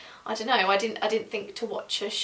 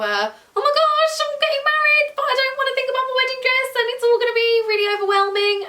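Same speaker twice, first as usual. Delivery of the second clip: panicked inner voice; 13 semitones higher, 5 dB louder than usual. Retold or imitated. Voicing her own panicked first thoughts.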